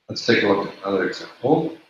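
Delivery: ordinary delivery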